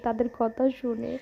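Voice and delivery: ordinary talk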